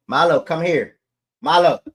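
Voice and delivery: loud, high yell for the dog; sharp call for a dog who won't listen. Real, not acted. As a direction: shout his name across the room. Yelling for disobedient dog.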